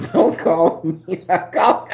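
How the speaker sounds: slow, laughing while quoting her; laughing through it. Real, not acted. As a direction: break into laughter on the words. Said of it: "the laugh got some tears in it".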